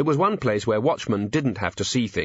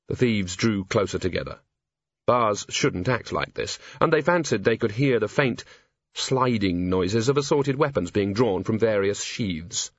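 ordinary delivery, nothing marked

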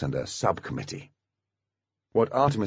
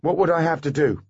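higher, louder wary question; suspicious; 8 semitones higher, 4 dB louder than usual. Acted. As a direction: ask it warily. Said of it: "Maine squinted suspiciously".

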